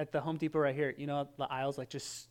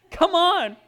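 exasperated high, loud cry; hangry outburst; 14 semitones higher, 13 dB louder than usual. Retold or imitated. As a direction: plead loudly in mock frustration, with a laugh. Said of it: "I'm getting hangry".

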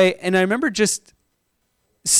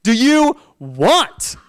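slow, higher, annoyed mom at the door; grumpy mom; 5 semitones higher, much slower than usual. Retold or imitated. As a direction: drag it out like an irritated mother. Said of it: "not the most pleasant woman".

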